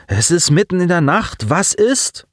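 put-on high-pitched demanding boy; pestering question rising at the end. A narrator's reading. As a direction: insist on knowing what's up, pitched high. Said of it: "forderte Colin zu erfahren".

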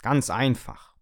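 high, loud know-it-all lecture; lecturing. Acted. Coffee machine lectures its owner, loud and lofty. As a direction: talk down to him like a teacher. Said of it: "belehrte mich die Kaffeemaschine".

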